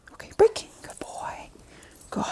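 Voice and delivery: soft breathy release and praise; whispered praise. Real, not acted. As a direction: say it softly to the dog.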